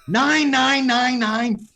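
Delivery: high, loud barked German count; German villain accent. Retold or imitated. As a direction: shout the count like a Die Hard villain. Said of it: "I think of Die Hard".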